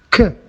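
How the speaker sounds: K said as the sound "kuh"; K as a short isolated "kuh"; consonant sound demo. Shown as a demonstration. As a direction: sound out K, not the letter name. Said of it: "as in course".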